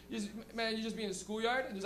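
normal talking voice

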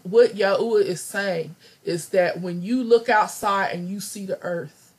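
ordinary talk, nothing marked

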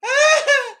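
high, loud cackle; a sudden real laugh bursting out; burst of laughter. Real, not acted. Reacting to a funny chat comment.

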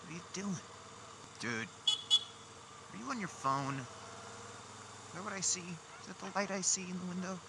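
high, disbelieving call to driver; high-pitched, exasperated questioning; incredulous. Real, not acted. Yelling at a driver on phone.

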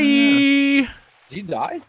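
sudden loud alarmed question; startled shout. Real, not acted. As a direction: yell it in surprise. Friend falls in game.

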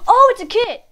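high, loud burst of surprise; excited discovery. Real, not acted. Player discovering the kit option, excited discovery. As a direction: exclaim it as it clicks.

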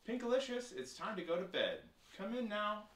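high, loud sing-out from afar; bellowed. A narrator's reading. Parents at the door calling child to bed, bellowing. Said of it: "bellowed voices from the door".

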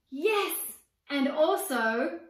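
high, loud eager call; a small girl bursting to join in; excited little sister. Acted. Little sister eagerly chiming in to her brother, excited.

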